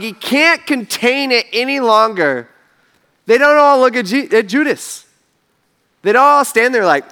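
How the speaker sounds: normal talking voice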